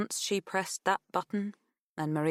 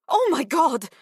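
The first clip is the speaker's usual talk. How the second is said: put-on loud, high Greek-accented outburst; overjoyed cry, far louder and higher; excited interruption; 10 semitones higher, 11 dB louder than usual. A narrator's reading. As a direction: burst in over the other speaker, thrilled. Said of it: "Maria cut across her".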